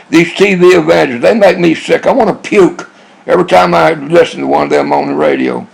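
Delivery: normal talking voice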